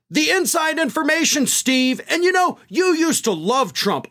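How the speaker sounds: high, sharp mock snap; imitating an indignant snap at the host; snapping. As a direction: throw it back at him, offended. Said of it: "That's when Habba snapped".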